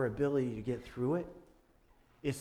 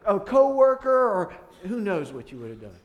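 loud, higher whiny protest; blaming God; 9 semitones higher, 11 dB louder than usual. Retold or imitated. A believer blaming God for slipping, loud whine. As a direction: complain to God like a victim. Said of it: "Don't we go".

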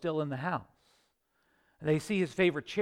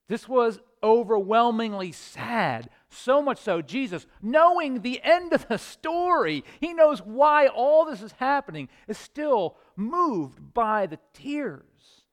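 slow, loud and much higher, pleading; impassioned preaching; 7 semitones higher, 8 dB louder than usual. Acted. Preacher stressing Jesus' grief to the congregation, slow impassioned preaching. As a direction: press every word home.

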